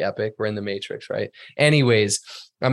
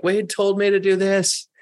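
mock complaining client; 9 semitones higher than usual. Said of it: "So no suing me like".

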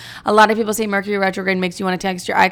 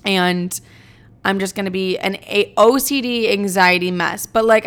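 usual speaking voice

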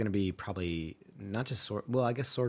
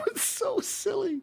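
very high laughing; 3 dB louder, slower than usual. Real, not acted. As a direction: squeal with laughter. Shrieking laugh.

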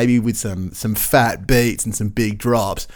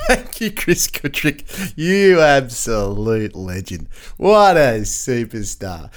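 mock grand tribute; much slower than usual. Real, not acted. Host's slow mock tribute.

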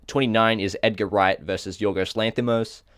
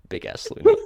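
laughing; 9 semitones higher, 5 dB louder than usual. Real, not acted. Host cracks up at the name.

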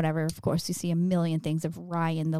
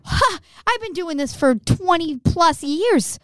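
gloating; 9 semitones higher, 5 dB louder, slower than usual. Real, not acted. Gloating about cheating.

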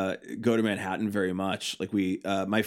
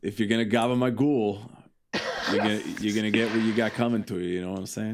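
put-on a playful New York tough-guy accent; hammed-up New York wiseguy talk, quick; mock New York accent. Joking with cohosts about gabagool, mock New York wiseguy accent. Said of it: "You're trying to get into the gabagool again".

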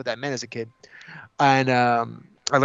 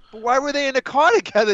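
put-on higher, puzzled little kid asking; 10 semitones higher than usual. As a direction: ask it like a confused child. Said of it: "he as a kid going".

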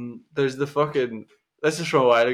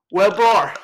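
calling out; 8 semitones higher, 8 dB louder than usual. Retold or imitated. Diego calls out to DJ.

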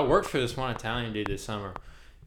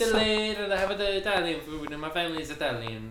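put-on Italian accent; 8 semitones higher than usual. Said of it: "he's like".

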